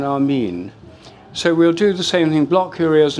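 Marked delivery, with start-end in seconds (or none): 0.0-0.7 "Amin" as ah-MEEN; long ee ending on "Amin"; long-E pronunciation. Shown as a demonstration. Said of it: "the sound E, as in".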